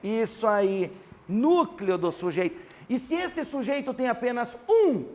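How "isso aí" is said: ordinary delivery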